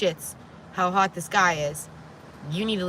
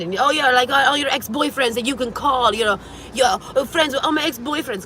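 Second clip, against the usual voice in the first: excited; 7 semitones higher, 6 dB louder than usual. Retold or imitated. Excited aside in retold speech.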